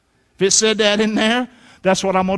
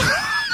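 short laugh. Real, not acted. Preacher laughs mid-point.

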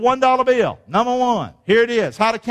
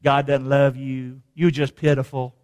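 low, insinuating tempter; Satan's taunt; 7 semitones lower than usual. Acted. Satan telling the downcast they are unloved, low taunt. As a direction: drop low to plant the doubt. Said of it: "doesn't Satan want you to make you think".

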